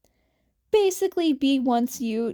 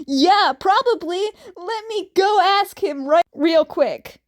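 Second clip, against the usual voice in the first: calling out loud; 5 semitones higher, 6 dB louder than usual. Acted. Dick calls back from afar.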